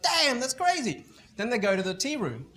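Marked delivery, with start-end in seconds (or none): 0.0-1.0 high, slow impressed awe; impressed surprise. Retold or imitated. Client impressed by the consultant's laptop, slow and amazed. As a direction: drag it out in wonder.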